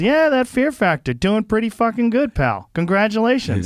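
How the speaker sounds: high-pitched imitation of a chummy comic; Dennis Miller. Comedian greeting a stranger at the urinal, high-pitched impression. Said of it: "He says".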